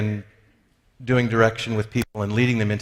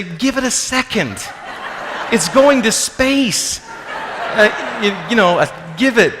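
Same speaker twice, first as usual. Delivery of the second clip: much higher, slowed, laughing voice of a comedian mocking impatience; Louis C K; 9 semitones higher than usual. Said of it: "The comedian Louis C K says".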